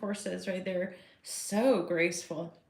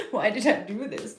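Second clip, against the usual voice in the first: mock despair; 4 dB louder than usual. To her audience, about choosing Earth, laughing mock despair. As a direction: throw up your hands through a laugh. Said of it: "It's like".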